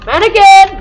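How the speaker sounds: high startled yell; screaming in fear. Real, not acted. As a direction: yell at the sight. Spotting mannequin in game.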